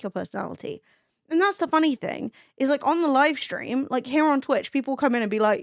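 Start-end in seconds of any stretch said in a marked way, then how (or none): none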